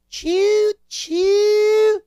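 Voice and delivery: loud, very high "choo choo" whistle; train whistle imitation. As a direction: hoot like a train whistle, last one long. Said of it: "you know, the whistle blow".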